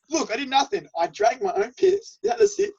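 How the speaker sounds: squeaky laughing plea of innocence; laughing protest. Retold or imitated. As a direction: insist you did nothing while cracking up. Retold pleading denial to friend.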